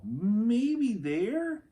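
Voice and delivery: put-on high, eager little girl voice; Annie voice. A narrator's reading. Narrator reading aloud gives Annie a high eager voice. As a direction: voice Annie as a bright, eager kid.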